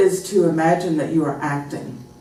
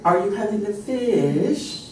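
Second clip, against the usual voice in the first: high, drawn-out British question; British melody; 4 semitones higher, slower than usual. Shown as a demonstration. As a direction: stretch FISH with a British swoop. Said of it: "We would never say".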